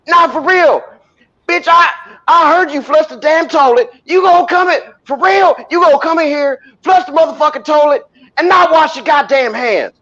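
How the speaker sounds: hammed-up furious rant at a stranger; angry public scolding. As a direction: tear into her in front of everyone.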